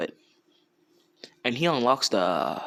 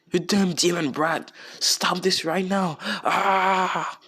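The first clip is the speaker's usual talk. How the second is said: higher, mock-furious yell ending in a cry; a hammed-up enraged old man; angry cry; 7 semitones higher, slower than usual. Danzo screams at Naruto.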